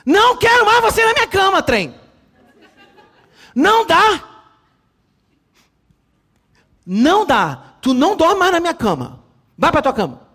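shouting at the little daughter, high and loud; angry shout. Retold or imitated. Father yelling at his daughter to leave his bed, angry shout. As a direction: lay down the law at full volume. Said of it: "Eu voltei de férias e falei assim".

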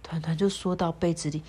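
ordinary delivery

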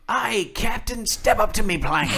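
imitating the counter lady calling him up like a pirate; pirate greeting. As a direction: ham up a cheesy pirate greeting. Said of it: "And here's what she chose".

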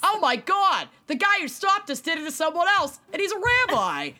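high-pitched, excited mock shout; shouting wife. Wife telling husband about the rabbi who stopped them, mock shouting. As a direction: shout it in a put-on New York accent. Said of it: "she shouted".

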